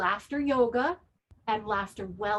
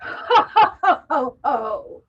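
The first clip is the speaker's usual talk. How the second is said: forced ho ho laugh; 9 dB louder, much slower than usual. Shown as a demonstration. Yoga teacher leading the class in laughter, loud forced ho ho. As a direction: push out ho ho ho on each breath. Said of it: "laugh out your mouth".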